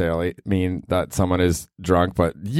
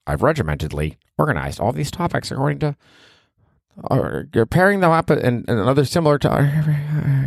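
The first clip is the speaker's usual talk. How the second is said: higher, fussy know-it-all voice; mock uptight planner; 8 semitones higher than usual. Mocking the over-planned podcast host, higher and fussy. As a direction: parody someone too organized.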